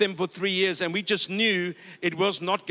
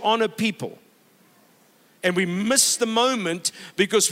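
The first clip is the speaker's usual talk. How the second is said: rising sermon climax, hammering key words; full-force preaching. Real, not acted. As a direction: drive the point home from the pulpit. Preaching climax, louder and emphatic.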